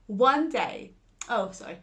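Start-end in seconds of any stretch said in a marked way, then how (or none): none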